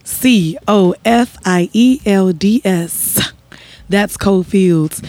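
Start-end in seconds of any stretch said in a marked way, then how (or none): 0.0-3.3 slow, letter by letter; spelling it out. Shown as a demonstration. Wife spells the website name slowly for the host. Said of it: "Spell it".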